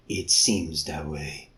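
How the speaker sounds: low, slow muffled creature; low slow beast voice. Acted. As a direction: drag it out, low and heavy. Guin low slow reply.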